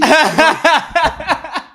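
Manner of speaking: real laughter. Real, not acted. Laughing at his own story.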